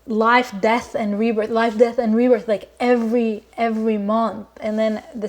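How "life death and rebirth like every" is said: usual speaking voice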